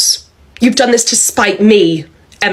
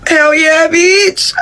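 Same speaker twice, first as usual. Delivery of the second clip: gleeful shout; 9 semitones higher, much slower than usual. Acted. Quitting her job, gloating.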